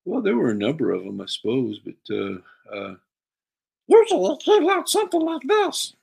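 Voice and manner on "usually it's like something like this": very high squeaky puppet voice; goofy high-pitched puppet character. Shown as a demonstration. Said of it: "what was your go-to, uh, puppet voice?".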